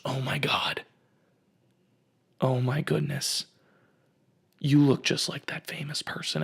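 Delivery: stunned, whispery gasp of recognition; imitating an amazed stranger under the breath; awed whisper. Awestruck lady spots lookalike.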